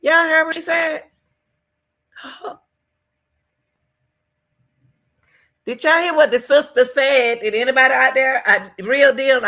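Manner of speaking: high, loud, fast disbelief; excited outcry. Real, not acted. Vlogger calls out to viewers after the sister's line, excited and loud. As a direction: call out to the audience, stunned.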